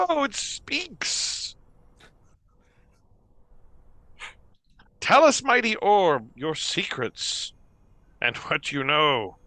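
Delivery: high, drawn-out mock amazement; hammed-up awe, slowly gushing in a high voice; feigned surprise. [name] feigning surprise at the talking orb, high mock awe. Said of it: "you feign surprise and go".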